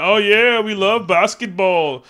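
loud, drawn-out fake Canadian accent; badly faked Canadian, stretching the words; mock Canadian accent. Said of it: "I don't know my Canadian accent".

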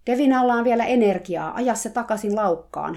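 loud, high shouted order; shouting. Acted. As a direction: yell it across the pen. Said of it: "hän huusi". (kovaa huudettu käsky)

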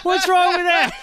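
high, loud mock outrage; squeaky fake innocence; mock indignant. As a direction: play it up like it's perfectly normal. Mock defending the sticker.

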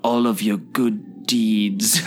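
slow, halting villain impression; hushed, drawn-out attempt at the film villain; Solomon Lane. Host imitates how the villain talks to his nemesis, slow menace. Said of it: "The way he talks".

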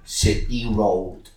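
slow, breathy sing-song slogan; mock slogan t-shirt voice. Mocking the t-shirt slogan, slow and whispery. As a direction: read the twee slogan drawn out and airy. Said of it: "it goes, like".

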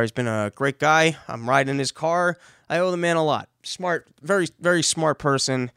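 ordinary talk

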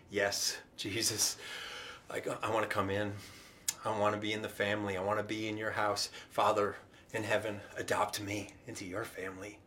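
low, soft, humble prayer; quiet prayer. Shown as a demonstration. Believer asking God for adoption, quiet sincere prayer. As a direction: pray it softly and low, sincerely.

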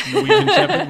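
high, loud laughing correction. Real, not acted. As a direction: blurt it out through laughter.

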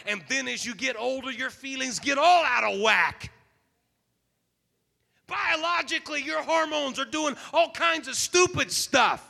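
loud, driving sermon delivery; preaching at full force. Acted. Preacher drives home the message to the congregation, loud and fervent. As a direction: preach it out with rising fervour.

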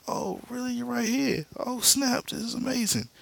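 very high, gleeful mock squeal; hammed-up boyish amazement way up high; amazed. Barry delighted his mom is here, amazed.

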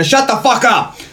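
high-pitched yell cutting someone off; mock angry outburst. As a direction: blurt it as an exasperated mock shout. Retold outburst at chipper colleague.

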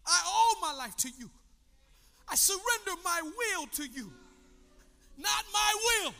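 high, ringing prayer with pauses; fervent prayer. Real, not acted. Preacher surrenders his will to the Lord, praying fervently. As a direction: pray it aloud as a fervent surrender. Prays aloud before congregation.

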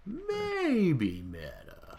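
slow catchphrase. Acted. Catchphrase repeated.

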